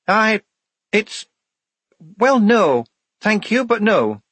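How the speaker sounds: high, hesitant then firm; falter, then decline in a high voice; Shufti, halting refusal. A narrator's reading. Shufti declining the widow's pension, high and halting.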